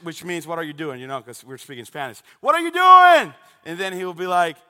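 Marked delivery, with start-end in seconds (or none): 2.4-3.3 high, full-voiced holler to a neighbor; loud yelled question, high-pitched; screamed question. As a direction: shout it so it carries next door. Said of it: "I would scream at Adrian".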